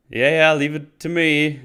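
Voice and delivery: loud, high, breezy brush-off; dismissive mock agreement. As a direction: wave it off, not meaning it. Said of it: "And she's like".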